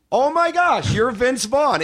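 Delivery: mock starstruck squeal; retold surprise. Fiancee surprised, spotting Vince Vaughn.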